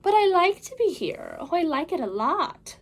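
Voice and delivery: put-on the Cat in the Hat calming the children, big and upbeat. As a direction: reassure the kids with a grand flourish. Cat answers cheekily.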